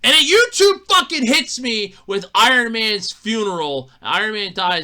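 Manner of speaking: ordinary delivery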